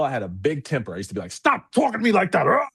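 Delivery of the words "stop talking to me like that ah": imitating his old angry yell, pitched higher; retold yell. Husband yelling at his wife in an old argument, mock angry. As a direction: snap it like the hot-tempered husband he was. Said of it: "I used to yell".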